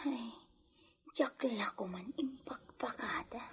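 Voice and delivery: whispered worried aside. Acted. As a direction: breathe it to yourself so nobody hears. Maid speaks aside quietly.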